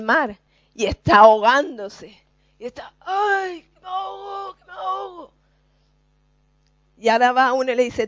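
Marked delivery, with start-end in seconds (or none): 3.0-5.3 high wailing cry for help; mock drowning cry. Preacher acting a drowning man's high panicked cry. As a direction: cry out like someone going under.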